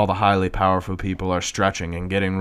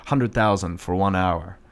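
plain reading voice

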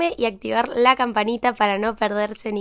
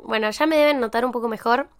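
ordinary talk, nothing marked

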